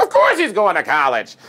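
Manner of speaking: a mom who won't hear otherwise; indignant proud mom. Retold or imitated. Mom insists on her son's future.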